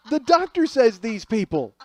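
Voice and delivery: loud, high start, easing on the quote; laughing comic outrage, starting at a yell; incredulous shouting. Real, not acted. Comic stressing that the doctor said it, incredulous shouting.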